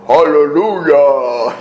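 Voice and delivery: slow, drawn-out booming imitation of a bass; imitated bass voice. Preacher imitating his friend's praise, deep bass voice. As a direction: put on a friend's big radio voice. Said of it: "he had this deep bass voice".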